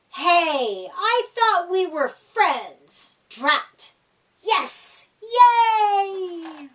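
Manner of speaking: one grumbles, one cheers; lightly voiced upset and happy animals; mixed crowd reactions.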